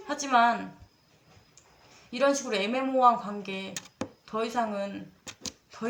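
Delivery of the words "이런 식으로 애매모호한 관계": ordinary delivery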